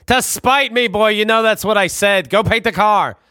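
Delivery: high, stiff imitation of a proper father; pompous dad voice. Father correcting his son, pompous and high. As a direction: lord it over the boy.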